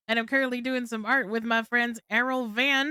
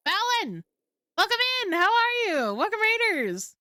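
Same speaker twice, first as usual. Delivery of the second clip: excited greeting; 9 semitones higher than usual. Real, not acted. Streamer greeting new raiders.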